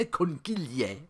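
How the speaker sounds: "Conchiglie" said slowly, with a hard k at the start; careful pronunciation. Shown as a demonstration. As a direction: slowly sound out "Conchiglie" as kon-KEEL-yay. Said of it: "Here's how you say it".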